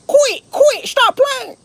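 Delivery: very high, loud squealed Alabama imitation; piercing hammed-up Alabama whine; mock Alabama cousins' accent. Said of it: "I used to tease my cousins 'cause they say".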